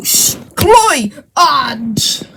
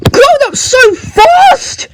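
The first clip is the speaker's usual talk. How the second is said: proud shout; 8 semitones higher, slower than usual. Acted. Proud parent figure exclaims.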